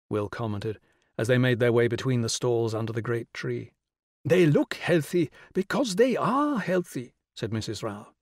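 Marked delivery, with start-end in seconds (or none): 4.2-7.1 Mrs Rao, insistent. A narrator's reading. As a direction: raise the pitch and stretch out the key words.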